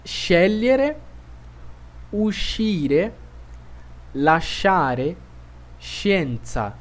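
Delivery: scegliere and lasciare with a sh sound; Italian SC demo. Shown as a demonstration. Teacher asking learners to listen to SC words. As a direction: model the sh clearly. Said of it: "prestem bastante atenção".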